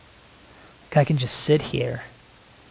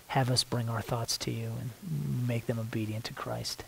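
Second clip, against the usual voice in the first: whispered prayer; 10 dB quieter than usual. Real, not acted. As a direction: breathe the words to God. Whispered line within prayer.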